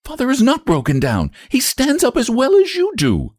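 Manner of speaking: put-on indignant girl. A narrator's reading. Joan defends her father against Lottie, high and indignant. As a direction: stand up for your father, high and hurt.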